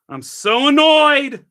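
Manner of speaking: high, loud, slow drawn-out wail; annoyed shout. Real, not acted. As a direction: stretch it out in a loud exasperated cry.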